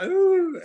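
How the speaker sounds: high, loud comic wail; mock crying wail. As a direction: burst into an exaggerated boo-hoo. Imitates himself crying on camera.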